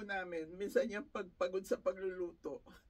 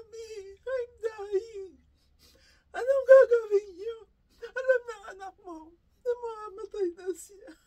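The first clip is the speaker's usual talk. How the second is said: crying through the quote; 10 semitones higher, 11 dB louder than usual. Real, not acted. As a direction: say his last words while weeping. Quotes dying son while crying. (umiiyak na inuulit ang sinabi ng anak)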